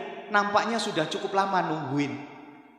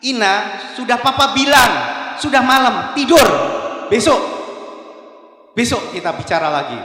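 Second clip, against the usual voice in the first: sharp, loud, high father's shout; tired father yelling at his young daughter, furious shout; angry snap at child; 7 semitones higher, 10 dB louder than usual. Retold or imitated. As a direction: snap at her to go to bed, much louder. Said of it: "dia bentak anaknya".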